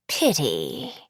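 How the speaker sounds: low sigh of disappointment. A narrator's reading. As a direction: sigh it out, low, as the old sorceress. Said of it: "says with a sigh".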